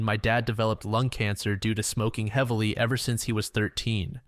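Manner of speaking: usual speaking voice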